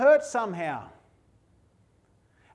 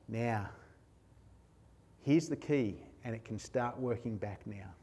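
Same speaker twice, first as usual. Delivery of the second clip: hushed sermon voice; 10 semitones lower, 11 dB quieter than usual. Acted. Preacher drawing the congregation close, low and hushed. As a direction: lower and softer, intimate preaching.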